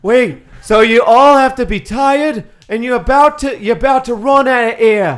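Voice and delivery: slow loud shout. Acted. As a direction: yell it out slowly.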